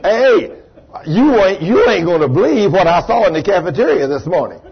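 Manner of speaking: loud, high, excited preaching; preaching climax. Acted. Preacher telling the congregation the news spread, rousing. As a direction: build it to a loud rousing peak.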